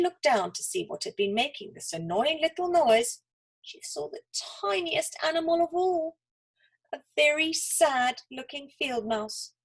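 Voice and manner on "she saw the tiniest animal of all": plain reading voice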